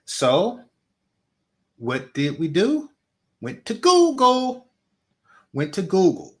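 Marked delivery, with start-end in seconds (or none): 3.4-4.7 high, slow and loud; emphatic high. Real, not acted. Rhetorical answer, emphatic.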